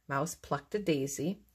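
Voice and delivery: ordinary delivery, nothing marked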